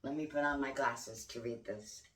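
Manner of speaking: ordinary talk, nothing marked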